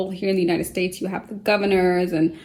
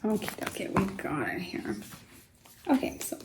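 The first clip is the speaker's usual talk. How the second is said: muttering to herself; 10 dB quieter than usual. Real, not acted. Muttering while searching the dictionary.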